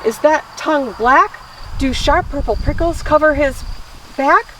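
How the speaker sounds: animated run of startled questions; alarmed story narration. A narrator's reading. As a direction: read as if discovering a monster. Mouse sees the creature.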